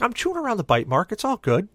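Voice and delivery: high-pitched brush-off; defensive excuse. Retold or imitated. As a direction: wave it away in a high voice. Brushes off brother's objection.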